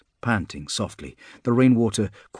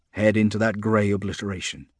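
ordinary delivery, nothing marked

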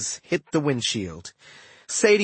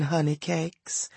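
soft, slow, sweet pet name; affectionate coo; 7 dB quieter, slower than usual. A narrator's reading. Hippo goddess to her adored dwarf, soft slow endearment.

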